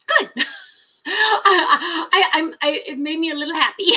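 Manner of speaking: giggling through the words; high, loud giggly admission; laughing. Real, not acted. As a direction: laugh it out, a bit giddy. Laughing while admitting happiness.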